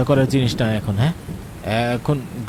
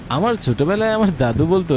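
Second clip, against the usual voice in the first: usual speaking voice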